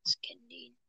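whispering. Real, not acted. Aside while joke is told.

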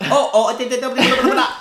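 high, loud mock gibberish; flustered babbling. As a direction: imitate someone sputtering loudly in another language. Mimicking a flustered foreign caller reply.

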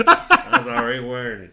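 high, loud, laughing through it; laughing quote. Retold or imitated. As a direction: crack up mid-quote. Laughing retelling of friend.

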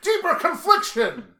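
high, loud, drawn-out shout; hammed-up duelist shout; mock battle cry. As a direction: call it out like a trap card attack. Shouted mock card-game move.

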